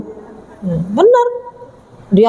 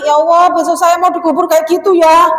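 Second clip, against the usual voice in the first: high, raised acted exclamation; dismayed mourner; 6 semitones higher, 4 dB louder than usual. Mourner at the graveside remembering death, exclaiming in dismay. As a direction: cry out in mock dread to God.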